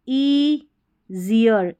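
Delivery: "easier" broken into ee, zi-yar with a gap; syllable by syllable. Shown as a demonstration. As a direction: slowly sound out "easier" in two parts. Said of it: "easier करके पढ़ेंगे". ("easier" को तोड़कर, ई... ज़ियर बोलें)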